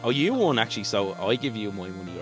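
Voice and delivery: ordinary delivery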